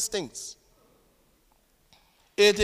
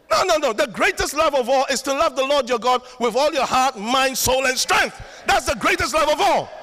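raised, loud preaching voice; emphatic correction; 5 semitones higher, 4 dB louder than usual. Real, not acted. Preacher correcting a song's message to church, emphatic and loud. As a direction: cry it out high and forceful, punching each word.

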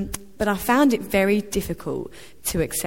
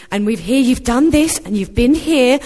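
bright gushing praise, higher and louder, no accent attempted; gushing relatives; 5 semitones higher, 9 dB louder than usual. Relatives from Australia greeting Rose, gushing, higher and louder. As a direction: rave about her like a proud aunt. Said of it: "in an Australian accent".

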